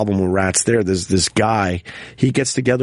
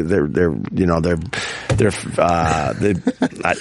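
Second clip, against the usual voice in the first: low, halting false starts; stammering; 7 semitones lower than usual. Real, not acted. Speaker losing the thread over the movie, stammering. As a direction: stumble over the words, pitch dropped. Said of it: "I'm, I'm stammering".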